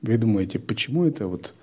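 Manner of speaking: ordinary delivery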